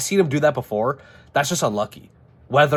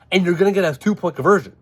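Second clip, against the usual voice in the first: normal talking voice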